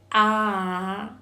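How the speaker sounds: A held long and loud; isolated vowel sound. Shown as a demonstration. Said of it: "I am going to pronounce".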